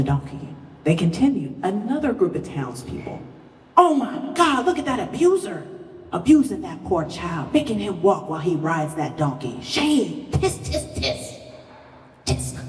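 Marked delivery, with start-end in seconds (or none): 3.8-10.3 outraged gossip. Retold or imitated. Outraged townspeople in a story. 10.3-12.6 breathy disapproving tsk tsk; soft unvoiced tsk tsk; tutting.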